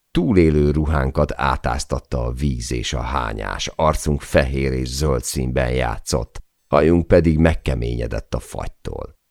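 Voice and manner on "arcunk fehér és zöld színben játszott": usual speaking voice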